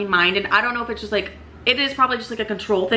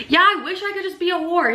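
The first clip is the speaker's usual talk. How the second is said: pitched way up, replaying the blurt; high mock retelling; 9 semitones higher than usual. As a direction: squeak out the embarrassing line high. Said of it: "I said that in front of the new man".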